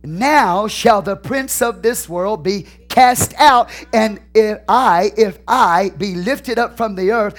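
slow proclamation building to a high shout; preaching. Acted. Pastor linking the angel to the cross, fervent shouted preaching. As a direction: build relentlessly to a shouted peak, then ease off.

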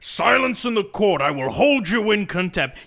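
high, loud mock judge; angry judge. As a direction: bang the gavel with your voice. Plays judge, shouting.

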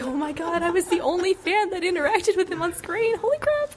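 high-pitched starstruck rush; acted-out fangirl excitement; thrilled realization. Acts out her thrilled realization.

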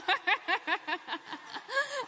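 big high-pitched burst of laughter; loud high laugh. Real, not acted. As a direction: laugh loudly at yourself. Laughing at own mock panic.

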